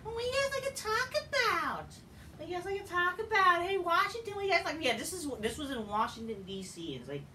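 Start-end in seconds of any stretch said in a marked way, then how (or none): 0.0-4.8 high, far mock wrestler voice asking the crowd. Vlogger mocking a wrestler working the Washington crowd, high and hammy. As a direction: ham up a wrestler begging the crowd for noise.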